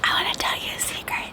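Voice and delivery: soft unvoiced whisper. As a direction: whisper it like a secret. Playful whispered secret aside.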